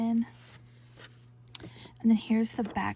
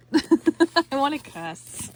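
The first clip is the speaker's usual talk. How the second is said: slow, laughing, giggly repeat; 4 semitones higher, 8 dB louder, slower than usual. Real, not acted. Crafter laughing to viewers, giggling it out. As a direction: laugh it out, stretched.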